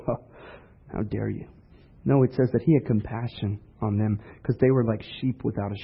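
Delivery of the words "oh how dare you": low, hushed mock indignation; mock scoff. Preacher showing what Jesus didn't do, quiet mock scoff. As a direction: scoff softly, as if offended. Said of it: "scoff and go".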